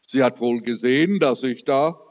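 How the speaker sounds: ordinary talk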